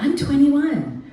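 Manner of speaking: high, slow sing-song answer; chirpy mock reply; perky ingenue. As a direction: stretch it out sweetly, way up high. Candy answering brightly.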